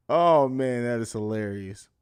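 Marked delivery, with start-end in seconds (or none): none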